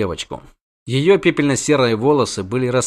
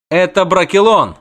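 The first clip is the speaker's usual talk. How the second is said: high, loud, proud retort; proud declaration; 6 semitones higher, 5 dB louder than usual. A narrator's reading. Dryad defends her forest to the witcher, proud declaration. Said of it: "гордо проговорила она".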